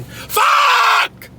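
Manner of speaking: very high-pitched furious scream; real frustrated scream. Real, not acted. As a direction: let the anger rip out in one scream. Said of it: "just me fucking screaming".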